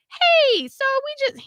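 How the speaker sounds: high, loud, sing-out phone greeting; smooth phone manner. Retold or imitated. Person calling the company for the speaker, phone manner. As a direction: pitch it way up like a practised caller. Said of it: "he's so good on the phone".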